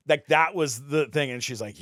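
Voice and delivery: usual speaking voice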